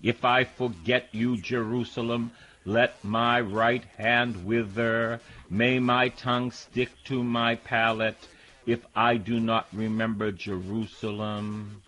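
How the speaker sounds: slow, mournful scripture reading; psalm reading. Acted. Preacher reading the exiles' lament, slow and sorrowful. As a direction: read it like a lament, drawn out.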